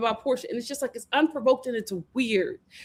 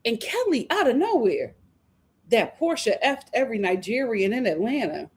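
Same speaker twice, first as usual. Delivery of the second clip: normal talking voice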